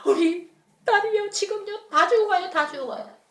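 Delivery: a father panicking that his daughter is dying; desperate pleading. Acted. Father begging Jesus to save his dying daughter, frantic and high.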